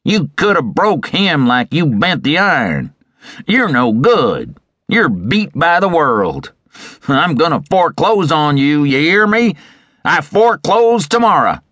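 creditor's tirade rising to a peak; angry cry. A narrator's reading. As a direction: cry out the threat in outrage. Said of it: "And now he cried".

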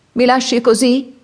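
high, protesting exclamation; acted exasperated outburst. A narrator's reading. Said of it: "esclamò Rocco esasperato".

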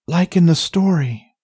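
usual speaking voice

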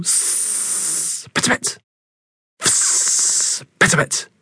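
unvoiced hissing and spitting; cat hiss and spit. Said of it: "with a hiss and a spit".

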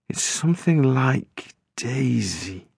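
lightly voiced boy, drawn out; soft guess. A narrator's reading. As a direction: narrator voicing the boy slowly guessing her name.

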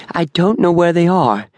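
high-pitched, desperate insistence; acted desperate denial. A narrator's reading. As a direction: plead it with the voice pitched right up. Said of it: "she insisted desperately".

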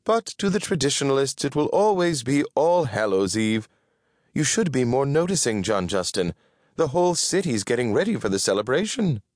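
high, quick, insistent voice; traditionalist Winifred. Acted. Winifred urging her partner to notice, high and quick.

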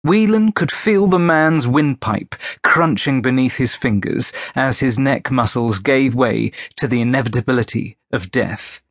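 usual speaking voice

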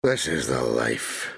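low, slow, satisfied drawl; deep contented sigh of pleasure; grinning contentment. A narrator's reading. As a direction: savour it slowly with a grin. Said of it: "Bob grinned".

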